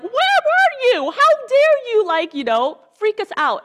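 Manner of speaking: hammed-up alarmed parent scolding; frantic parents. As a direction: shriek it like a frightened scolding mother. Parents scolding lost twelve-year-old Jesus.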